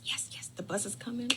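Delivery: breathless eager whisper; a child's thrilled whisper; excited whisper. Retold or imitated. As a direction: hush it, the bus is almost here. Excited kids whisper bus arriving.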